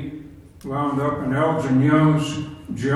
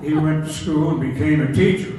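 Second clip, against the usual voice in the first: laughing; 5 dB louder, faster than usual. Real, not acted. Laughing at own story.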